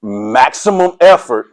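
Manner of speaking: Maximum effort spelled out slowly, each word weighted; slow, weighty coach emphasis. Shown as a demonstration. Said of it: "I even said it with some emphasis".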